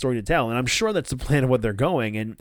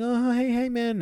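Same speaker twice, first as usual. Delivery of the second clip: awkward greeting; 12 semitones higher than usual. Retold or imitated. Dark Order guys greeting awkwardly.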